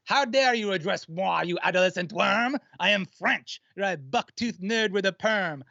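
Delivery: put-on French accent rap verse; haughty French accent, rapped; french chef. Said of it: "I'll do the first verse".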